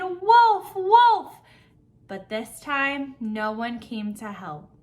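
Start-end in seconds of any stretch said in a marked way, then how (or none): 0.0-1.4 high-pitched, loud alarm call; shouting. A narrator's reading. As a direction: cry out urgently for help. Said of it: "The boy shouted and shouted".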